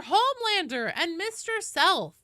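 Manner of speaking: put-on high Valley Girl voice, falling tune on Homelander; Valley Girl call with a settled falling end; Valley Girl accent. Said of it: "The Valley Girl voice".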